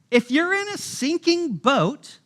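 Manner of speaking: ordinary talk, nothing marked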